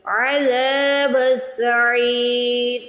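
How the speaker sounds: عَذَابُ السَّعِيرِ with a throaty ain and long ذا; stretched ain and dhal. Shown as a demonstration. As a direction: recite عَذَابُ السَّعِيرِ slowly, drawing out ذا and عی. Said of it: "پھر را کی آواز آئے گی". (عذاب میں عین کی آواز اور زال کھینچ کر)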